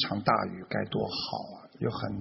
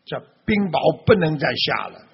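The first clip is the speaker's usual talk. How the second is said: louder, higher cry of protest; alarmed cry; 4 semitones higher, 8 dB louder than usual. Acted. Farmer pleading with the falling hail, alarmed cry. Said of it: "惊叫起来".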